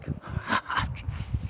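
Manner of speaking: short breathy chuckle; laugh. Real, not acted. Joker laughing at his own crude gag, short chuckle. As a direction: chuckle at your own joke.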